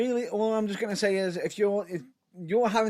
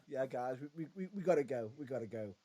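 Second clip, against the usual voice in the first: calm, soft, low retelling of an exit line; 6 semitones lower, 10 dB quieter than usual. Retold or imitated. Retelling wrestlers leaving a brawl, very calm. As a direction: say it quietly, trailing softer, unbothered. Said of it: "But very calmly".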